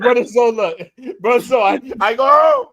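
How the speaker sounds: a tipsy Dominican announcing he's going home; drunk Dominican. Retold or imitated. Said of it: "He sound like a drunk Dominican".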